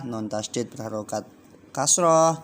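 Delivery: normal talking voice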